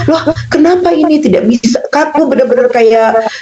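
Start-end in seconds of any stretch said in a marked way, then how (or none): 0.1-1.8 higher-pitched baffled exclamation; retold bewilderment. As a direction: reenact your startled puzzlement. Retelling her bewildered reaction.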